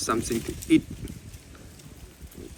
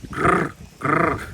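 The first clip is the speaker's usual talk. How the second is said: louder, higher monkey growl; playful growly monkey call; monkey language; 5 semitones higher, 6 dB louder than usual. Retold or imitated. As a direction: mimic the monkeys talking. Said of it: "You see the language of monkey".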